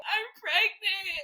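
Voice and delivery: high, very slow mock wail; retold wailed announcement. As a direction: stretch the news into a high wail. Retold call breaking news to partner.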